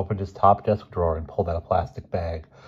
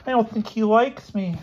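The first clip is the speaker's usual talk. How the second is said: very high, squeaky schoolboy; timid little voice, pitched way up; meek Elmer; 5 dB louder than usual. Acted. Boy explaining his roommate to the headmaster, squeaky and meek.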